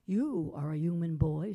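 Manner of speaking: skeleton voice. A narrator's reading. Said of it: "said the skeleton".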